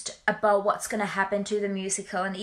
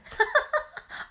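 giggle. Real, not acted. Giggling while showing her room.

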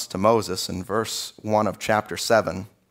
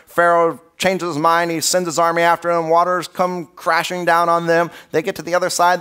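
ordinary talk

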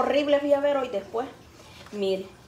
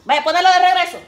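louder, higher repeat; louder second take of same order; louder insistent; 7 semitones higher, 10 dB louder than usual. Real, not acted. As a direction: insist firmly.

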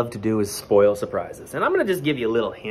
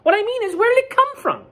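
very high, loud, impatient duck; huffy duck; 6 dB louder than usual. Acted. Duck pressing Goose about the egg, huffy. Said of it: "huffed Duck".

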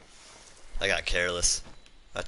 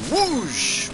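excited whoosh; 5 dB louder than usual. Real, not acted. Big charged attack lands.

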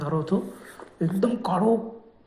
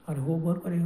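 usual speaking voice

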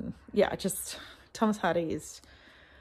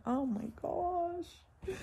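awed exclamation; 10 semitones higher, 5 dB quieter, much slower than usual. Real, not acted. Admiring the book.

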